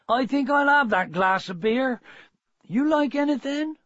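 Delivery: high-pitched country farmer, lifting to a question; rustic farmer, cheery. Acted. Farmer offering his wife a drink, high and friendly.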